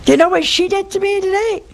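high, fast, loud aggrieved complaint; man complaining to the preacher, high and indignant; indignant husband. Retold or imitated. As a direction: squeal it like an outraged husband.

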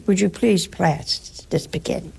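prim, overly polite English request; mock polite English. Mocking the English at the dinner table, prim English. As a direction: put on a dainty English manner. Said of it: "Some nationalities would say, maybe the English".

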